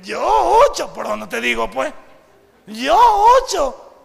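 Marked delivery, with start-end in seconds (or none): 0.0-0.9 mock disbelief. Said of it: "algunos se ríen, dicen". 2.7-3.9 mock disbelief. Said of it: "algunos se ríen, dicen".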